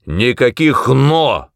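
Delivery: slow, loud, angry refusal; barked retort. A narrator's reading. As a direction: bark back, hitting each word. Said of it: "рявкнул он в ответ".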